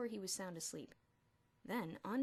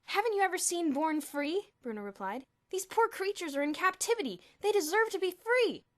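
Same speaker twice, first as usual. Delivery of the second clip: put-on lofty boy voice; 10 semitones higher, 12 dB louder than usual. Bruno's lofty character voice.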